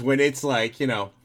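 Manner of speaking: normal talking voice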